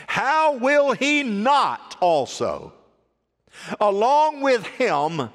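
very slow, drawn-out, weighty phrasing; emphatic scripture reading. Real, not acted. Preacher reading Paul's verse to the congregation, slow and emphatic. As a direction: stretch each word, pausing between phrases.

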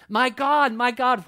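loud, high retold cry from the cross. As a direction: cry out to God in anguished appeal, as Jesus. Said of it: "he yells out from the cross".

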